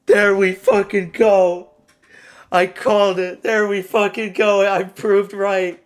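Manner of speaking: loud, high, drawn-out gloating; elated victory yell; triumphant shouting. Real, not acted. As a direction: crow over your winning pick. Celebrating a correct prediction.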